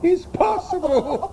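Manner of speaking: slow line through laughter; barely holding the laugh in. Acted. Fabian laughing after Malvolio leaves, high and slow.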